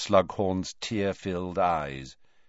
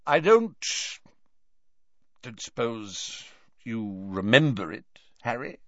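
awkwardly; 4 semitones higher than usual. A narrator's reading. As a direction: feel your way into an awkward question. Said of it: "he asked awkwardly".

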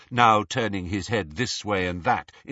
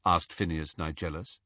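usual speaking voice

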